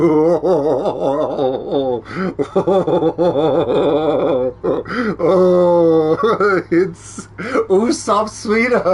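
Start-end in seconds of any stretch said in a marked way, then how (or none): 2.1-6.7 laughing till it hurts; breathless crying laugh; helpless laughter. Real, not acted. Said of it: "my cheeks hurt". 7.7-8.8 blurting it through laughter; words squeezed out while laughing. Real, not acted. Laughing at the photo.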